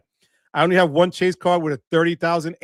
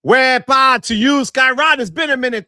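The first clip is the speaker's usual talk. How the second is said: wepa shout-out; 7 semitones higher, 9 dB louder than usual. Real, not acted. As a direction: call it out big and proud. Shouting out a fan.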